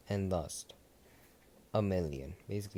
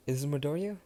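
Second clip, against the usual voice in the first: eager phone pickup; 7 semitones higher, 3 dB louder, faster than usual. Acted. Momo answering eagerly.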